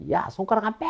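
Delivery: sharp high scolding mother; mother yelling at baby to stop sucking fingers, imitated; imitating wife's yell. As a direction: snap at the toddler like an angry mom. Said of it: "이렇게 소리를 질렀습니다".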